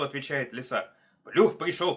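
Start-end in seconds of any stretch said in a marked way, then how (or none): 1.2-2.0 loud, raised, quick cry; higher, punchy warning shout; wolf's warning. A narrator's reading. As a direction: call the alarm out sharply. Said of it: "повторяет волк".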